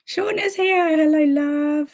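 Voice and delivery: high, bright hello to a viewer; host greeting a chatter who just joined, thrilled; delighted greeting. Real, not acted. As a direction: greet him like a friend arriving. Greeting live chat arrivals.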